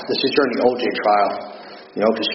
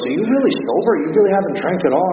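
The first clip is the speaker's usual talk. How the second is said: retold disbelief, pitched well up; incredulous questioning; 6 semitones higher than usual. Wronged friend reopens the door to check he is sober, imitated incredulous questioning. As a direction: imitate someone astonished, voice jumping higher. Richie incredulous at his sobriety.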